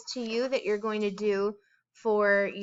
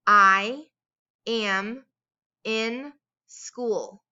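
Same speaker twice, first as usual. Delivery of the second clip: slow with pauses between words, first word louder; word-by-word dictation; 6 dB louder, much slower than usual. Shown as a demonstration. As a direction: separate each word. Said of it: "You are going to repeat the sentence".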